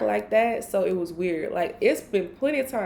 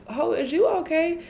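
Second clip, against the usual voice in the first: high, mock-baffled question; high incredulous question to a friend; 8 semitones higher than usual. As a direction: pitch it up in disbelief. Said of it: "And I'm like".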